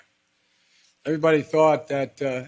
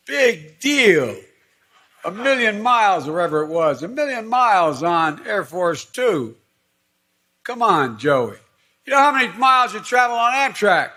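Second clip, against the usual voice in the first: animated teasing; 7 semitones higher, 6 dB louder than usual. Retold or imitated. Conductor teasing quote.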